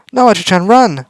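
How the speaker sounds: high, loud cry to flee; girl screams for Archie to flee; frantic warning shout. Acted. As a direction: shout at her to run.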